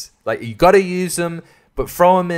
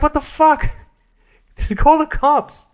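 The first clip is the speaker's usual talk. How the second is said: mock disbelief; 14 semitones higher, 4 dB louder than usual. Reviewer mocking the heroine's reaction, in high mock disbelief.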